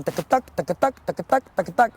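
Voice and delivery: vocalized canter beat tuck-a-tuck; rhythmic canter sound. Shown as a demonstration. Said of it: "you can feel, like, the definition".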